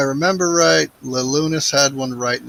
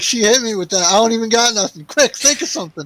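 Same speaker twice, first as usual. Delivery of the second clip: flustered inner panic; 8 semitones higher, 5 dB louder than usual. Retold or imitated. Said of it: "I was like".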